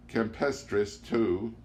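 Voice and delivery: ordinary delivery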